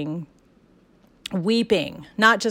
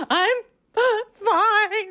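much higher, squeezed out through mock tears; sobbing "I'm fine"; 5 dB louder than usual. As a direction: act out crying while insisting you're fine. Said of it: "And I'm bawling my eyes out".

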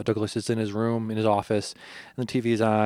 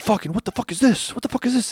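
high, frantic yelling; a man under fire shrieking in disbelief; panicked yell; 12 semitones higher than usual. Retold or imitated. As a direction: scream it in panic as bullets fly. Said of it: "He's like".

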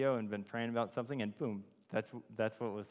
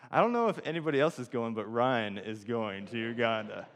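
amused announcement; 8 dB louder than usual. Retold or imitated. Friend declares the reluctant recruit is going, loud announcement. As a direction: call it out to the room, slowing at the end. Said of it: "everybody laughed and was like".

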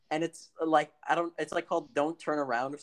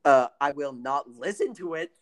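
ordinary delivery

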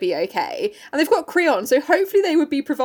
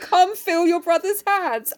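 imitating Dad; 6 semitones higher, slower than usual. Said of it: "can you imagine Dad saying".

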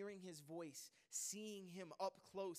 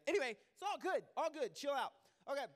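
loud reassurance; 7 semitones higher, 8 dB louder, faster than usual. Real, not acted. Preacher calming a distracted crowd.

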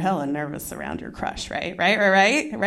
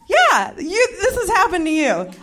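usual speaking voice

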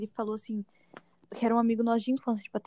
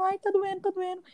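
high-pitched put-on whining; mock tearful complaint; 9 semitones higher than usual. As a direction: play up the pain like a crying child. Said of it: "eu chegava chorando". (choramingo fingido de dor)

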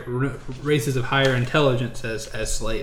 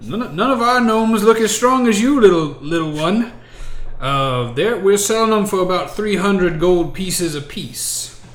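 higher, louder slave trader voice; slaver character; 5 semitones higher, 4 dB louder than usual. Acted. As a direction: play a trader sizing up a little customer. Game master as slaver naming price.

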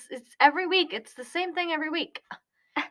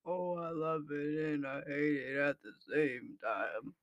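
extremely deep bass voice; 12 semitones lower, 10 dB quieter than usual. Shown as a demonstration. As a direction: drop into the lowest bass you have. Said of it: "That was pretty deep".

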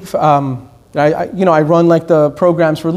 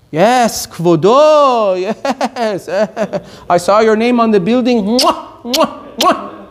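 mock fawning; 3 semitones higher, slower than usual. Flatterer bows to a rich donor, mock fawning. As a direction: gush in mock reverence, drawing out the yeses. Mocks people bowing to the rich.

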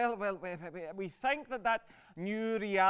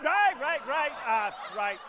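loud, high, repeated cut-in; agitated attempt to restore order; flustered interruption; 6 semitones higher, 7 dB louder than usual. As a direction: break in hastily to shut the talk down. Cutting off an offensive exchange.

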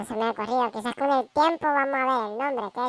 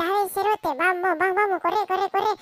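urgent; 6 semitones higher than usual. Acted. Urging injured mutant to run.

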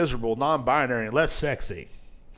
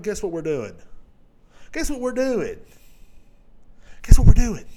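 plain, then higher, then a loud mock shout; mock building excitement; 6 semitones higher, 8 dB louder than usual. Mock company hyping its gift to fans, building to a loud yell. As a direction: build each repeat into a hammed-up yell.

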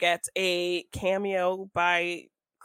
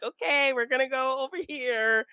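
cute exit; 7 semitones higher than usual. Retold or imitated. Mocking a cute club exit.

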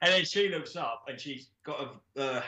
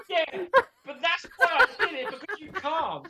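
laughing; 13 semitones higher, 4 dB louder than usual. Retold or imitated. Captor voiced, laughing.

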